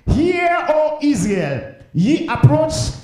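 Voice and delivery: loud, high, drawn-out herald's call; ringing pulpit declamation; priestly proclamation. Acted. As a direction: summon a whole nation, slowly. Proclaims the priest's address to Israel.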